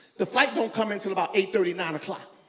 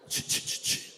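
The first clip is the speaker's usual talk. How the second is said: noise imitation; 7 dB quieter than usual. Imitates a noise in bathroom stall.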